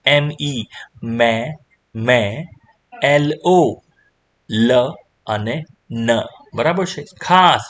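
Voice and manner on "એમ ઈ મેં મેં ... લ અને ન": slow, "મેં", "લ", "ન" set apart by pauses; letters first, then "મેં", "લ", "ન" drawn apart; sounded out. Shown as a demonstration. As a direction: spell and sound out "મેં", "લ", "ન" piece by piece. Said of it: "શું વાંચશો".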